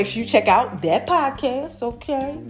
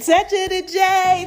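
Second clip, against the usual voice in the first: playful sing-song; 8 semitones higher, 4 dB louder than usual. Pretend. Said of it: "Y'all like it when I do it like that?".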